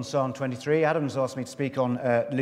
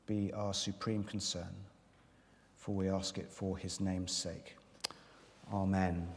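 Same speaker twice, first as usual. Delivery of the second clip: soft, earnest prayer voice; hushed reverent prayer; 5 semitones lower, 5 dB quieter than usual. Acted. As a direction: pray quietly before the sermon. Opening prayer.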